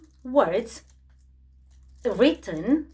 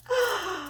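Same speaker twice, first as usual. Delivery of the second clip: gasp; 8 semitones higher, 5 dB quieter than usual. Acted. Delighted at the costume.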